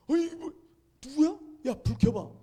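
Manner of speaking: high, loud startled questioning; startled alarm. Retold or imitated. Student finds a stranger in his room, startled. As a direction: blurt it out in retold alarm.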